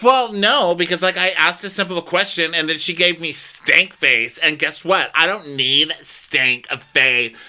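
high-pitched, whiny mock outrage; mock indignant. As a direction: imitate an affronted guest ranting. Acted indignant guest complaint.

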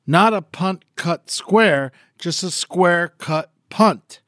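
slow, careful reading; slow tongue twister recitation. Shown as a demonstration. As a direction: pace each word out. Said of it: "a little dirty tongue twister".

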